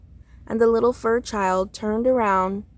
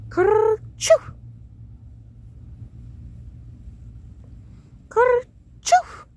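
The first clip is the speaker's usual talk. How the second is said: big sneeze; 13 semitones higher, much slower than usual. Acted. Fur child sneezes in story.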